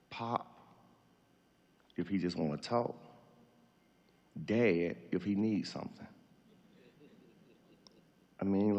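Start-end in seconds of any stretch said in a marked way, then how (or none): none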